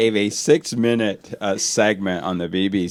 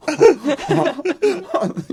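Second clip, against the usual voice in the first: high, wordless stifled laugh; imitated teenage reaction. Father showing his daughter's reaction, imitated high laugh. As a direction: imitate a teenager helplessly cracking up, pitched high. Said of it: "My, my fourteen-year-old was going like this".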